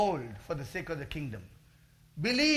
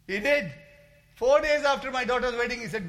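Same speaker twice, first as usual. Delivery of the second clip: ordinary talk, nothing marked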